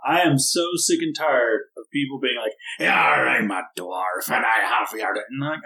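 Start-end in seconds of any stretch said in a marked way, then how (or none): 2.8-5.1 hint of a clichéd pirate voice, dropping low; mock pirate dwarf. Fed-up player mimics dwarf role-players, mock pirate. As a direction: put on a stock pirate dwarf, parodying bad players. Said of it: "gonna sound like a pirate".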